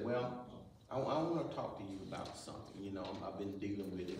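low, soft, lightly acted approach; hesitant confiding. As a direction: quietly broach a private matter, quick and low. Acting someone approaching to confide.

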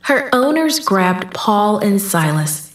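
usual speaking voice

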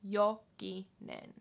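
Jokinen said slowly and evenly; slow syllables. Shown as a demonstration. Teacher repeating name slowly. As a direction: spell out Jokinen by ear. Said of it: "slow model".

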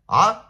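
a sudden furious bark of a yell; short loud angry shout. Acted. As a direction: roar it out to cut him off. Thug yells in confrontation.

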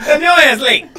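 high, loud tag to the joke; loud teasing. Real, not acted. Comedian roasting his partner for lateness, loud teasing. Punchline after the laugh.

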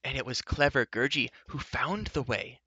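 proud, animated creature; Gurgi, proud. A narrator's reading. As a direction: boast like an eager little creature. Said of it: "put in proudly".

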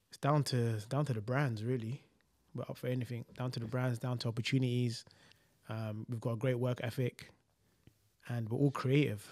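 soft, formal press junket answer; interview voice. Pretend. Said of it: "He's in the interview".

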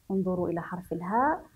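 normal talking voice

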